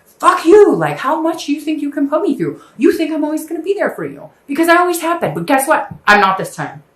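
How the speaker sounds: top-of-the-lungs rant at a cheating partner; screaming at an ex. Retold or imitated. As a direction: scream it at the ex who keeps hurting you. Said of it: "I feel like screaming at the top of my lungs".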